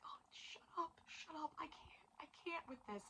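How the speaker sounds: breathless whisper of disbelief; overwhelmed whisper. Retold or imitated. Reviewer reliving the cliffhanger, overwhelmed whisper. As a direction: whisper it like you can't take it. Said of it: "and I was like".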